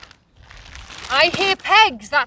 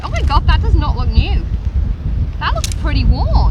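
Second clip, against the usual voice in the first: surprised; 3 semitones higher, 7 dB louder than usual. Real, not acted. Tent looks used.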